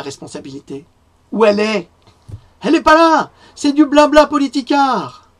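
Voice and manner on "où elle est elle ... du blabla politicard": shouting, much higher and louder, furious; angry outburst. Real, not acted. Ranting at the health minister about responsibility, furious shout. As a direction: blast her with indignation.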